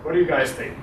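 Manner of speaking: ordinary talk, nothing marked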